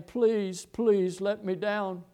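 high, drawn-out plea; pleading. Acted. Preacher acting out what Jesus never said on the cross, pleading. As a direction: beg to be let down, voice lifted high.

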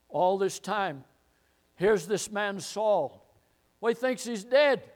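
ordinary talk, nothing marked

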